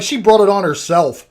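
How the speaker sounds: drugged patient mocking the pregnant neighbor, shouting; loud outburst. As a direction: sneer and shout, fully unhinged. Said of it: "the outburst".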